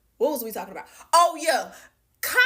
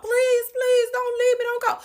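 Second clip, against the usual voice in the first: high, imitated sobbing beg; mock crying plea; 13 semitones higher, 3 dB louder than usual. Ex-boyfriend begging her not to leave, mock crying. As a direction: whine it like a man begging through tears. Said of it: "Like, crying his eyes out".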